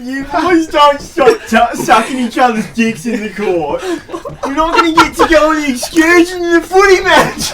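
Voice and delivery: loud, high mock teacher scolding; hammed-up impression of a ranting schoolteacher; Mister Murray. Teacher telling boys off about the excursion, mock scolding. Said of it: "Do the Mister Murray".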